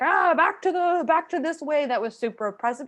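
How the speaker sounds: imitating a high, loud, whiny lament about old habits; hammed-up whine, high and loud; mock regretful sigh. Mocking artists who slip back into oppressive habits, high, loud whiny lament. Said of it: "and we're like".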